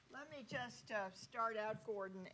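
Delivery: ordinary delivery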